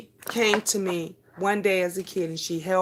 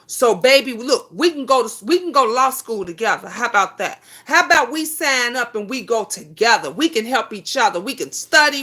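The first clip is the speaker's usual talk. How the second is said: excited urging; 3 semitones higher, 5 dB louder than usual. Real, not acted. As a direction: sell her on the plan with energy. Urging viewer to law school together.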